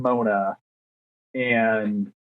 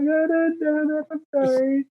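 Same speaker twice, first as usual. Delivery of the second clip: much higher, mock cowering apology; meek pleading apology; 12 semitones higher than usual. Newcomer begging coworkers not to yell, in a high, cowering mock voice. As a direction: beg them not to yell, pitched way up.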